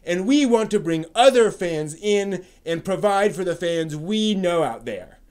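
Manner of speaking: mock pompous reading. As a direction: read it like a smug scold. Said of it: "someone has a chip on, on their shoulder".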